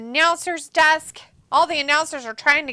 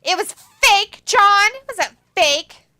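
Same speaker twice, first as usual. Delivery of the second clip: higher and louder, insisting it was not fake; protesting, louder, to John; raised protest; 8 semitones higher, 6 dB louder than usual. Real, not acted. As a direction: argue back at John. Arguing with John about fake blood.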